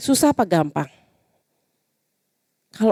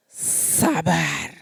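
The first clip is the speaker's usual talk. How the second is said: soft, lower sabar on the out-breath; calm exhale; 5 semitones lower, 6 dB quieter than usual. Shown as a demonstration. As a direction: say it slowly to calm yourself. (sabar pelan dan lembut setelah tarik napas)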